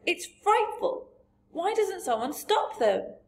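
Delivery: put-on high, dismayed child's outcry; Sophie. A narrator's reading. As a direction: protest in horror, pitched far up. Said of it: "Sophie cried".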